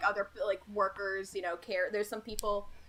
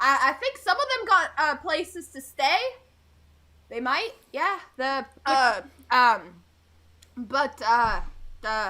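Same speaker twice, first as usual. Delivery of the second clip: put-on drunk local; 3 semitones higher, 5 dB louder, slower than usual. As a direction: stumble through the list like a drunk. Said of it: "He's like".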